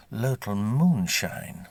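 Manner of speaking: ordinary delivery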